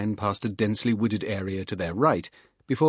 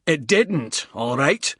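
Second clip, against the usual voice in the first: snapping; 6 semitones higher, 5 dB louder, slower than usual. Acted. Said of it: "Bald snapped".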